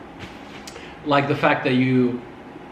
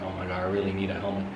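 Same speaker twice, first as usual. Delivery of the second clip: fast and softer, a quick panicky mumble; rushed aside; 7 semitones lower, 9 dB quieter, much faster than usual. Retold or imitated. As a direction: rattle it off under your breath. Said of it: "And I was like".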